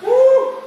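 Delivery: high, loud celebratory whoop; excited whoop. Real, not acted. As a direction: whoop to pump up the class. Coach whoops after set.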